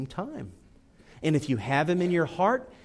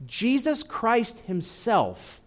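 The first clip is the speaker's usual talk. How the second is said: normal talking voice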